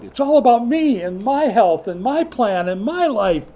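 high, loud, self-absorbed complaint; self-centered whine. Acted. Proud Jonah complaining to God, self-centered whine. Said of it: "But Jonah is proud".

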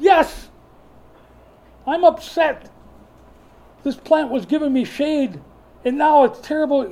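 high, loud whining complaint; petulant complaining. Acted. Jonah complaining to God about the plant, whining. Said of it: "He said".

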